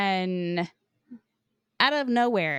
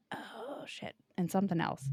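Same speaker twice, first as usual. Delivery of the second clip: whispered aside; 10 dB quieter than usual. Real, not acted. Forgets the fourth item.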